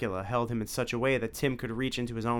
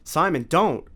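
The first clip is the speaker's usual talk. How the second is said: loud urgent plea; calling out; 6 semitones higher, 8 dB louder, slower than usual. A narrator's reading. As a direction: shout to stop him. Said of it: "called Tim".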